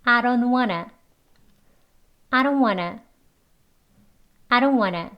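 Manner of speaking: "I don't wanna" joined as "ai-ron-wanna"; connected model phrase, no t in "don't"; linked words. Shown as a demonstration. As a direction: run DON'T into WANNA with a tapped d. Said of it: "이렇게 발음 이어서".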